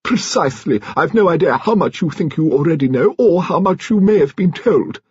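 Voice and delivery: high-pitched, declamatory grande dame; imperious old-guard lady. A narrator's reading. Lady Lacklander confessing on behalf of her husband, imperious.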